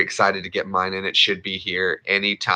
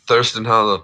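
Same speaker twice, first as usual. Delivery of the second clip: usual speaking voice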